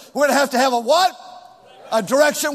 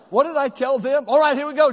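normal talking voice

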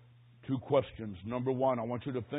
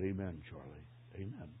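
very low, soft amen; quiet, low retold response; hushed pastor; 9 semitones lower, 13 dB quieter than usual. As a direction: murmur it gently at a deathbed. Pastor at a deathbed.

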